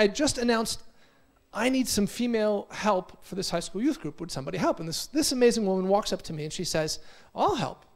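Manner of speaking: normal talking voice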